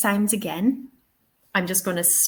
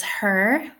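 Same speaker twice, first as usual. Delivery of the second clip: her with the Irish R pronounced at the end; Irish "her", R sounded heavily; Irish accent. Shown as a demonstration. Teacher gives the example word, Irish accent with sounded R. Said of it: "in my Irish accent".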